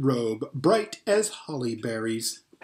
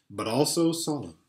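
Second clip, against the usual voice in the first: plain reading voice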